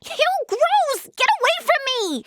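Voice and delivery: very high, grossed-out shriek of protest; disgusted squeal. Acted. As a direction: squeal in disgust and shoo it away. Recoiling from something gross.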